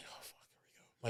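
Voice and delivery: barely audible, quick whisper; whispered dread. Retold or imitated. Groom bracing not to cry, quick whispered aside. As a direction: whisper it hurriedly to yourself. Groom muttering to himself, retold.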